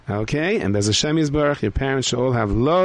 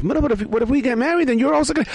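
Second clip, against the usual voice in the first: high pleading voice; 14 semitones higher, faster than usual. Acted. Character pleading.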